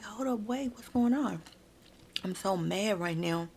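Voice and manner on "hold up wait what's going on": put-on higher, alarmed boyfriend voice; worried boyfriend. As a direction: voice the boyfriend catching her arm, concerned. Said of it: "He's like".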